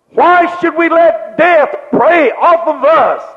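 ordinary delivery

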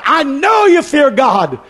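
high, drawn-out, forceful declaration; emphatic repeat. Acted. Angel's line, repeated with force.

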